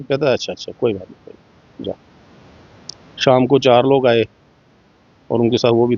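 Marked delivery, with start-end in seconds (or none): 0.3-1.9 quiet brush-off. Retold or imitated. Militant backs off, letting it go.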